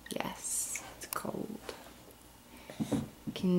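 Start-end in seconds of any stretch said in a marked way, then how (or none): 0.1-1.6 soft unvoiced whisper. Shown as a demonstration. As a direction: whisper it to the baby.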